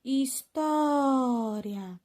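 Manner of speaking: História drawn right out on TÓ; stressed syllable demo. Shown as a demonstration. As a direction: slowly sound out História, holding TÓ. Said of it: "A sílaba mais forte é a penúltima".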